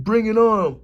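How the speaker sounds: mock taunt. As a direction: throw the dare back mockingly. Mocking the taunt.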